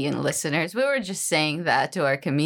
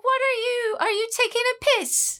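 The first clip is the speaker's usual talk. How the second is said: very high, squeaky mock British accent; hammed-up indignant British accent. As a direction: accuse him in a shrieky fake British accent. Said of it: "are you doing a British accent".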